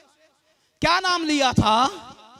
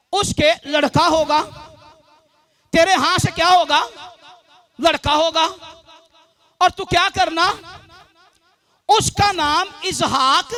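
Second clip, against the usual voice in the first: emphatic, raised scripture retelling; preaching; 5 dB louder than usual. Real, not acted. Preacher retells God's promise to Abraham, emphatic preaching. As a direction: declare it phrase by phrase, asking and answering.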